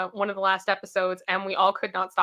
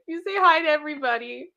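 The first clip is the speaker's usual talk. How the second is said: ordinary delivery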